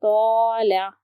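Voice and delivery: तौला with a full, heavy "tau", mouth filled. Shown as a demonstration. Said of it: "इसे पढ़ते वक्त देखिए मुंह भर जाता है".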